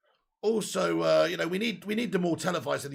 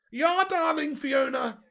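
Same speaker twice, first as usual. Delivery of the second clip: put-on high, loud German accent; hammed-up German catchphrase voice; 9 semitones higher, 5 dB louder, slower than usual. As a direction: greet them in a high-pitched comic German voice. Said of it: "I just always do Germans with a high-pitched voice".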